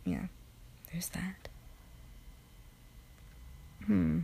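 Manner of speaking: normal talking voice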